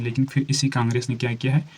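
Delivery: normal talking voice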